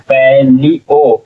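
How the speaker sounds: Palaeo slowly, as pai, lee, o; syllable by syllable. Shown as a demonstration. Teacher builds the word for learners, palaeo syllable by syllable. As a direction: break palaeo into three drawn-out syllables. Teacher sounds out, then blends.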